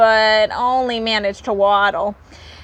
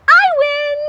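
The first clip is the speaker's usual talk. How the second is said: very high squeaky win; little rabbit voice; 5 dB louder than usual. Acted. Little rabbit to the others, squeaking triumphantly.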